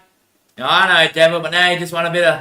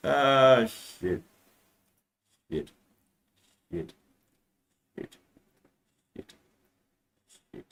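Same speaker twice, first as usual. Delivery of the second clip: muttered curse; 4 semitones lower, 10 dB quieter, slower than usual. Real, not acted. Curse after laughing.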